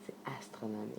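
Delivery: hushed, as if too big to say aloud; awed whisper. Real, not acted. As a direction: breathe it out in a whisper. Awestruck aside to viewers.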